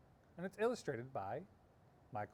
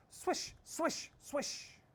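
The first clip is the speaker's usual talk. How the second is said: whispered swish; 13 semitones higher, much slower than usual. A narrator's reading. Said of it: "went in slow circles".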